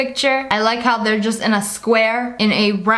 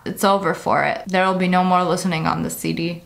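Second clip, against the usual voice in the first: ordinary delivery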